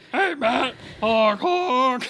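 high goofy clown honking; silly clown. Pretend. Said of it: "Don't put a clown nose on".

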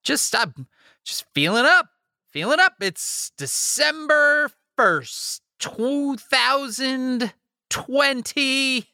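high, insistent pep with drawn-out date; forced upbeat. Acted. Host selling December to weary co-host, forced upbeat. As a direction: push the cheer way up. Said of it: "Do you hear it in my voice?".

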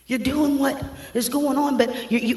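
normal talking voice

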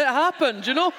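high, loud insistence; insistent. Real, not acted. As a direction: insist it really happened. Arguing over football.

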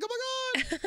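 high squeal, way up; squealed oh my god. Retold or imitated. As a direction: squeal it like a starstruck fan. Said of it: "I'm like".

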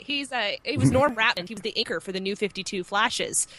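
usual speaking voice

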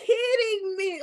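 upset at her own mistake, loud and slow; upset disbelief. Retold or imitated. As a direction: shout it slowly in mock despair at yourself. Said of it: "I was so upset with myself".

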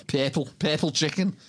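"purple chicken" in Scouse, purple as "pair-pull"; Liverpudlian accent. Shown as a demonstration. Showing how you spot a Scouser, Liverpudlian "purple chicken". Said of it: "just the two words in the Liverpudlian accent".

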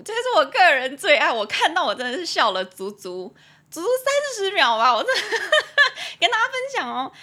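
high, loud giggly delight; can't stop laughing while sharing it; real laughter breaking through. Real, not acted.

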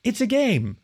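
high, bright soothing puppy voice; calm the chicken like a playful pup; puppy woof. A narrator's reading. A puppy officer soothes the armed chicken, high and bright. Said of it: "she woofed".

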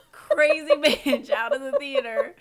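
laughing, pitch breaking up. Real, not acted. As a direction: laugh through the words. Laughing at own image.

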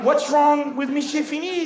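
high, bewildered pupil's protest; baffled complaint. Acted. Baffled pupil after teacher storms off.

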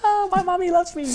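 put-on high, little-kid delight; childlike voice. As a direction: sound like a happy little boy. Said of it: "And I'm like".

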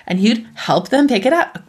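ordinary talk, nothing marked

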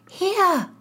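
ordinary delivery

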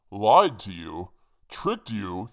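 loud, slow opening in the hangman's voice; mocking hangman. A narrator's reading. Hangman answering his accuser, loud indignant retort. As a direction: throw the questions out as the hangman.

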